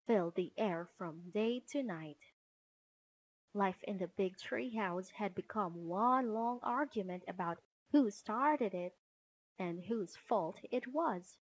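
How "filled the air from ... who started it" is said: usual speaking voice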